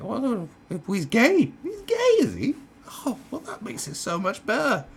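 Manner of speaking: high-pitched mock realisation; hammed-up relief at the news; sarcastic delight. Comedian pretending an excuse fixes everything, sarcastically delighted.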